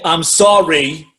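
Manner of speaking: put-on slow New York accent on sorry; Tony Danza's accent. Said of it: "in his accent".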